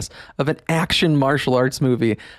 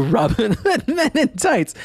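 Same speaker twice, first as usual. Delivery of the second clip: laughing; 8 semitones higher than usual. Real, not acted. Laughing while naming comedy.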